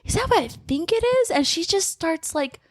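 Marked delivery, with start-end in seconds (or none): 0.0-1.3 high, gasping mock shock; mock surprise. Friend retelling Sasha waking up, high mock shock. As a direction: play up her fake surprise. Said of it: "And she's just like".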